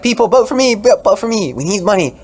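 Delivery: high, loud, excited stump pleading; hammed-up frantic politician; campaigning candidate. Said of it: "So it's like".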